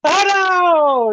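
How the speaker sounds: high, loud, drawn-out "oh no"; mock panic. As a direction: wail it in hammed-up alarm. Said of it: "He's like".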